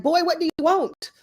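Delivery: high-pitched, exasperated retort; mock exasperation at a pestering caller. Annoyed reply to repeated calls.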